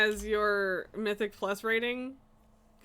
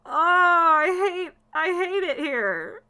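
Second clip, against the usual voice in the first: slow, louder, higher wail of frustration; exasperated whine; 4 semitones higher, 5 dB louder, slower than usual. Real, not acted. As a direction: drag it out like you're done with everything. Raging at abandoned dungeon.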